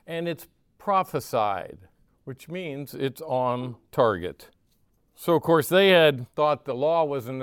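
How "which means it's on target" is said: normal talking voice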